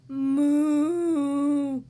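long, loud cow's moo. Acted. Pig answers Mister Silly, long loud moo. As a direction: moo loudly and hold it. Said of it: "said the pig".

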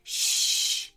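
long urgent "shh"; urgent shush. A narrator's reading. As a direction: hush everyone at once. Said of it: "hushed the wombat urgently".

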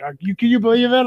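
high, loud excited outburst; freaking out. Retold or imitated. Excited friend on FaceTime right after the winning shot, freaking out. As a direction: retell a friend losing it with excitement. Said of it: "freaking out".

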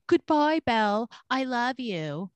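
high-pitched, put-on goodbye, slowing at the end; hammed-up parting, drawn out on the last words; mock farewell. Father to the daughter being kidnapped, mock farewell.

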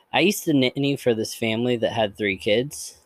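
usual speaking voice

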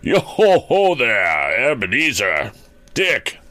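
slow, high, fat jovial call; drawn-out merry old boss calling out; oily, rich, jovial. A narrator's reading. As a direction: hail the lads in a big comfy voice. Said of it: "called out in a comfy, oily, rich, fat, jovial voice".

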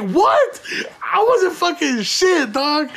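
very high, squeaky startled "what"; high panicked disbelief; shocked denial. Retold or imitated. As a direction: recoil from the accusation, scrambling. Accused out of nowhere, shocked.

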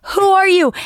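imitating the bewildered woman; confused hiker. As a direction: demand it, lost and scared. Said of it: "She's like".

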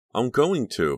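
guiltily insistent, pitch jumping up; guilty. A narrator's reading. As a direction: protest with a touch of guilt. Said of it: "feeling guilty".

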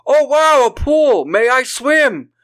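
high, loud gushing; mock excited Trent. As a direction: gush over the pool. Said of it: "He's like".

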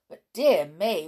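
usual speaking voice

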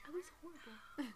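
whisper. Real, not acted. Confessing, whispered.

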